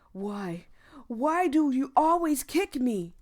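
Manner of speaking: loud, high anguished cry at the bullies; pained shout. Acted. Milo crying out at his bullies, loud and hurt.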